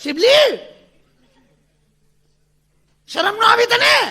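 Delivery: very high, loud mock shout; hammed-up jealous yell; scolding wife. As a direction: shriek the rival's name in outrage. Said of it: "ખીજાણી".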